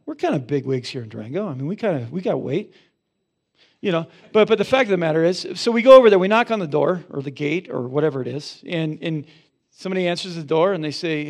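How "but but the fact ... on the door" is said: higher, much louder, rushing into the story; excited storyteller surge; excited build-up. Real, not acted. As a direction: burst out with it, eager and fast.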